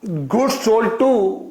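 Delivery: very slow, word by word dictation; crawling dictation pace. Shown as a demonstration. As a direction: dictate it slowly for note-taking. Teacher dictating slowly to class.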